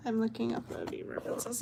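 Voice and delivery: hushed quick self-repeat; quick quiet repeat. Real, not acted. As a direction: rattle it off softly under her breath.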